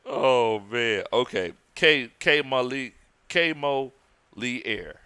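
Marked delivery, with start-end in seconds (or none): 1.7-5.0 "K Mo Lee Air" in slow, separate pieces; sounding it out. Real, not acted. Host correcting a viewer's name, sounding out "K Mo Lee Air". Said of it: "let me know if I'm saying it wrong".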